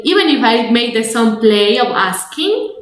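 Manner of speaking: ordinary talk, nothing marked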